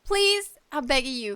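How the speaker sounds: ordinary talk, nothing marked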